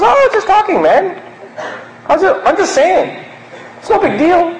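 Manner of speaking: high, breezy brush-off with a laugh; airy dismissal. Retold or imitated. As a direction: laugh it off as nothing. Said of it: "He goes".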